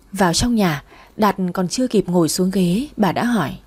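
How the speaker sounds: usual speaking voice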